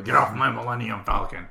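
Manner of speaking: put-on gruff movie-hero voice; jokey impersonation of a screen hero; Harrison Ford impersonation. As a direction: play the action star giving an order. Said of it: "that's my Harrison Ford impersonation".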